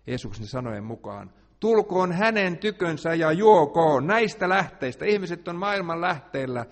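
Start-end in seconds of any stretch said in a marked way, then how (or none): 1.6-5.1 preaching invitation. Acted.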